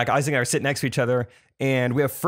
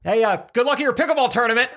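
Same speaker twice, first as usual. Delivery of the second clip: high, raised call across a cabin; mock calling out; 8 semitones higher than usual. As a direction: call out heartily to an acquaintance. Calls out to Thomas on plane.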